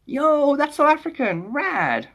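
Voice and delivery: high-pitched delighted exclamation; excited. Real, not acted. Streamer thrilled at a South African viewer, excited. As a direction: exclaim it high, coming down on the last word.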